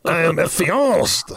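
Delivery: slow mock French accent. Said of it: "I'm French now".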